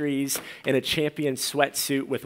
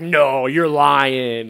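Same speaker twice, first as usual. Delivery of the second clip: louder, slow mock disbelief; disbelieving friend; 7 dB louder, much slower than usual. Friend told he's a pastor, loud mock disbelief. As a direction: drag it out like you can't believe it. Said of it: "He was like".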